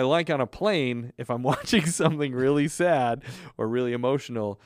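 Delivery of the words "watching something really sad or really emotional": ordinary delivery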